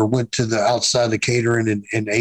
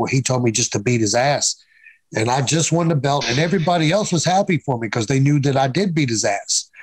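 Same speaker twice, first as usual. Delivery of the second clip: ordinary talk, nothing marked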